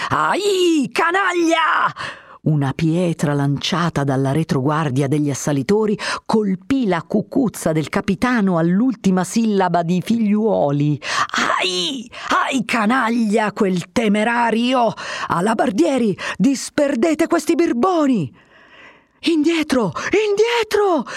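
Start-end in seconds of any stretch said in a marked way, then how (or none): 0.1-1.9 high, drawn-out cry of pain and insult; yelp, then curse them slowly; pained yell. A narrator's reading. Captain struck by a stone, crying out. 11.6-18.5 high, slow angry orders; furious captain. A narrator's reading. Captain ordering halberdiers against the crowd, furious. 19.2-21.1 guards shouting. A narrator's reading. Halberdiers pushing back the crowd, shouting. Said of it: "gridavano gli alabardieri".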